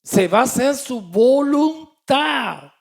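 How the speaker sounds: loud, fervent pulpit voice; fervent preaching. Acted. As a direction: thunder the message to the congregation. Preaching at full force. (predicando con fuerza desde el púlpito)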